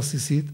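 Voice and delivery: סיסית with "s" sounds; rejected pronunciation. Shown as a demonstration. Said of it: "אף פעם לא אמר". (סיסית בסמ"ך)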